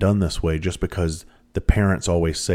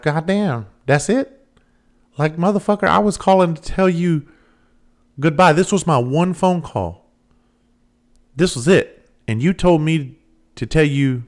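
higher and louder, incredulous rant; outraged, pitched-up disbelief; exasperated outburst; 10 semitones higher, 5 dB louder than usual. Real, not acted. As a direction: vent the disbelief at full volume. Outraged at dad's reply.

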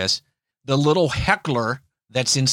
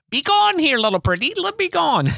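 much higher, cackly wicked witch voice; Wizard of Oz witch; 13 semitones higher, faster than usual. Retold or imitated. Preacher banishing trouble in a hammed-up witch voice. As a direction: shriek it like the Wicked Witch of the West. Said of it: "I'm on the Wizard of Oz little kick".

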